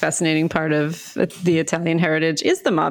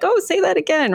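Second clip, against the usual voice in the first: much higher-pitched imitation of a delighted listener; mock fascination; 12 semitones higher than usual. Friend's family fascinated by the accent, mock delight. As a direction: coo it up high, imitating an admirer.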